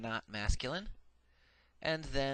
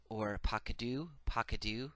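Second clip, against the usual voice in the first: ordinary delivery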